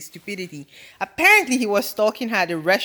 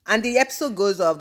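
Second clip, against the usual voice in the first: ordinary talk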